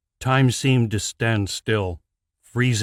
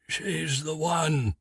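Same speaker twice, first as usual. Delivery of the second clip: coughed out; 6 semitones higher, 4 dB quieter, slower than usual. Acted. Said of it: "Alistair coughed".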